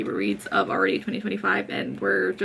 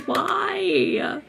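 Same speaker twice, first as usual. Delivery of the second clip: anguished why; 9 semitones higher than usual. Retold or imitated. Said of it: "but I'm just like".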